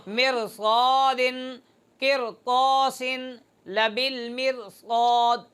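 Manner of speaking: slow, with a heavy रा in "मिरसादिन", "किरतासिन" and "मिरसाद"; drawn-out recitation. Shown as a demonstration. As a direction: recite each word slowly, stretched, with a full heavy रा. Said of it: "इस रा को फिर मोटा पढ़ेंगे".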